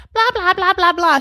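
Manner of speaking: high, loud blah-blah babble; mock chatter. Imitating Julia talking on while ignored, high mock chatter. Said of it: "while Julia's talking, like".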